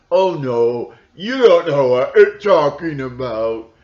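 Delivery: loud, high mock brush-off; mocking a know-it-all, high and loud; mocking dismissal.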